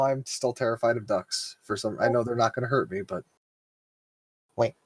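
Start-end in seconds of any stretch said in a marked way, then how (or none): none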